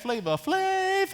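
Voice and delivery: high, drawn-out hype-man call; playful hype-man shout-out voice. Acted. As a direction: stretch it out in a high-pitched holler. Shouts out the rapper's catchphrase name.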